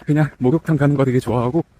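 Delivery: talking mid-jog, a bit winded; while running. Real, not acted. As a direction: speak while running at an easy pace. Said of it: "이제 막 뛰기 시작했고".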